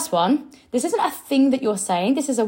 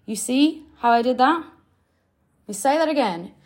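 usual speaking voice

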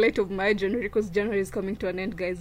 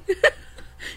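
breathy laugh; 9 semitones higher than usual. Real, not acted. Laughing at her joke.

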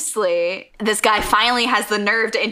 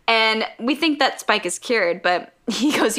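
ordinary delivery

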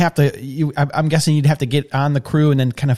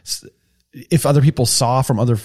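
ordinary talk

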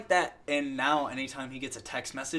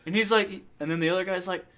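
ordinary talk

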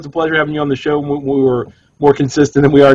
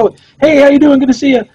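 chirpy customer greeting; 12 semitones higher, 3 dB louder than usual. Retold or imitated.

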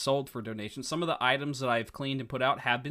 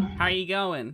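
higher-pitched mock New Zealand accent; bad Kiwi accent; 7 semitones higher, 4 dB louder, slower than usual. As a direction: put on a poor Kiwi accent. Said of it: "It's not what they sound like".

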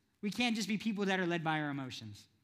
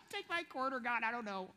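soft, teary-sounding mock plea, a hint of a whimper; a lightly voiced guilt-tripped viewer, soft; mock soft pleading; 2 semitones higher, 7 dB quieter than usual.

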